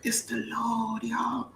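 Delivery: breathy stage whisper; preacher whisper. Pretend. Whispering to the congregation for emphasis. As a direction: hush it to the church.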